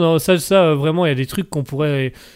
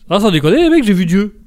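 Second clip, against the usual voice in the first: excited disbelief; 4 semitones higher than usual. Retold or imitated. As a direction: marvel like you just saw God. Driver exclaims seeing God.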